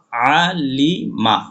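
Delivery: very slow, drawn-out syllables; slow reading. Shown as a demonstration. Teacher spelling the word for students, very slow. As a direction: spell out Alima slowly, syllable by syllable. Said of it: "তাড়াতাড়ি যদি পড়ি আমরা".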